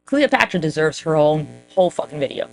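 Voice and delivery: normal talking voice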